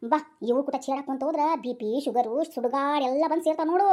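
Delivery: ordinary delivery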